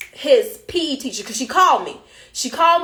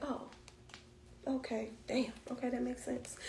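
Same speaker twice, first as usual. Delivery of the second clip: much softer, muttered okay damn; quiet realisation; 21 dB quieter than usual. Retold or imitated. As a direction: drop right down, taking it in. Quietly taking in teacher's news.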